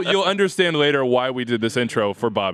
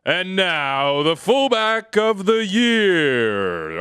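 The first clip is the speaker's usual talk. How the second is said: slow, drawn-out awards-show announcer; milk it like a gala host; 5 semitones higher, much slower than usual. Pretend. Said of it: "Commit to it".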